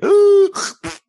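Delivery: loud high gasp of shock. Retold or imitated. Sarah hearing the news, loud shocked gasp. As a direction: suck in air in horror.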